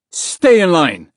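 loud, drawn-out command; yelled order. A narrator's reading. Soldier ordering prisoners in the hallway, yelling. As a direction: yell it slowly as an order. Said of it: "the soldier yelled".

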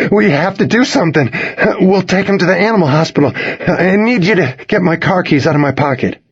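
high, pressing instructions; urgent distress. A narrator's reading. Owner telling friend to get the keys, urgent. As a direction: take charge through panic. Urgent orders during emergency.

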